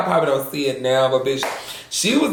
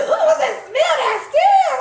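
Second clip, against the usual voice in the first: high, loud, laughing squeal of disgust; waking to a smell, a high laughing squeal; mock shriek; 4 dB louder than usual. Retold reaction to smell.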